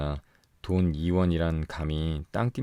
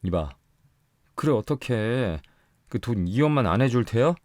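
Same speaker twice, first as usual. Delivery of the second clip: pressing demand, growing louder and higher; threatening; 6 semitones higher, 4 dB louder than usual. A narrator's reading. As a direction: lean on her with menace. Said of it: "위협하는 어조로".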